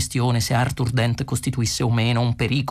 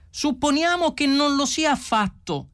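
much higher, projected across the field; shouting reply; 13 semitones higher than usual. A narrator's reading. Ford shouting his answer to Prosser, high call. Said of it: "gridò Ford".

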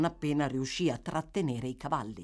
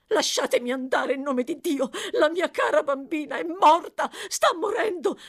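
high, loud, panicked mother; desperate wail; 10 semitones higher, 6 dB louder than usual. Acted. Mother begging to go to her dying child, desperate. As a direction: climb in pitch toward hysteria.

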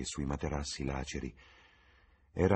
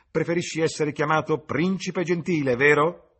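much higher and much louder taunting yell; yelled after him; 12 semitones higher, 10 dB louder than usual. A narrator's reading. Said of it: "gli urlò dietro".

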